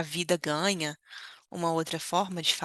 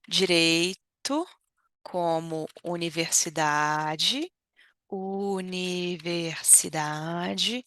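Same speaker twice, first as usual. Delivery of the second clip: slow model; much slower than usual. Shown as a demonstration. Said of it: "temos aí, ó".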